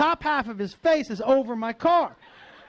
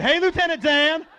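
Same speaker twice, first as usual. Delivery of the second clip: dim, friendly Southern greeting, pitched up; Forrest Gump; 4 semitones higher than usual. Acted. Stoned driver greeting a cop, Forrest Gump impression. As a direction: hail him like an earnest simpleton. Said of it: "I went full Forrest Gump on this dude".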